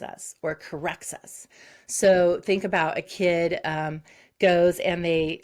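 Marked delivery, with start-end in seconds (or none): none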